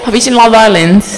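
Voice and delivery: put-on Essex, playful and loud; Essex accent. Shown as a demonstration. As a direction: do a Love Island Essex accent.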